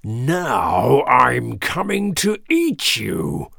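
slow, drawn-out troll roar; big slow ogre-like growl. A narrator's reading. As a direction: stretch the words out like a menacing troll. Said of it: "roared the troll".

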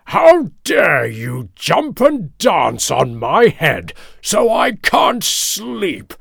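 slow, emphatic lion growl, rising in pitch; outraged big lion, stretching each word; angry King Lion. A narrator's reading. Lion scolding the mouse for dancing on his head, angry growl. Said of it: "King Lion said".

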